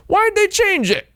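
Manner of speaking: squeaky high complaint; mock outrage. Real, not acted. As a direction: pretend to be scandalized. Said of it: "joke about localization".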